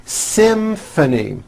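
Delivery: SYMPHONY slow and high, M held into F. Shown as a demonstration. Teacher modelling a tricky word, slow M-to-F SYMPHONY. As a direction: draw out SYMPHONY so M and F both sound. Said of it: "M sound followed by an F sound".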